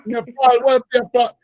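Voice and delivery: mock Jamaican mom in full dialect; mother greeting them in a high Jamaican accent. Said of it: "full dialect, whatever the full accent".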